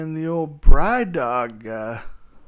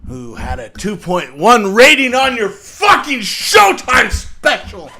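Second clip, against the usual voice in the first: loud, high outburst building through the phrase; angry shout; 6 semitones higher, 10 dB louder than usual. Acted. Berating a comic over his Showtime special, angry shout. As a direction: blow up at him in a shouted jab.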